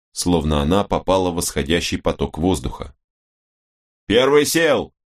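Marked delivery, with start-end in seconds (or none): 4.1-4.9 short radio shout. A narrator's reading. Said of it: "короткий рапорт".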